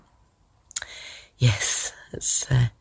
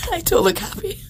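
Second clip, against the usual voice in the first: laughing through tears; 3 dB quieter than usual. Real, not acted. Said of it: "but I'm very happy".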